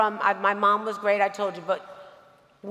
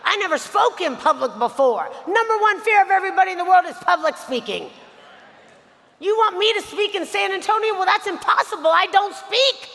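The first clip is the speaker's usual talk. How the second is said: panicked protest; 7 semitones higher than usual. Retold or imitated. Eighteen-year-old [name] protesting to her mentor about speaking, panicked. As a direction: protest in nervous, laughing alarm. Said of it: "I was like".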